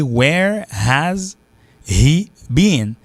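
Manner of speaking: "Where has he been" slowly, word by word; as written. Shown as a demonstration. Teacher reading the grammar form, "Where has he been" fully separated. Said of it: "w ap wè l ekri".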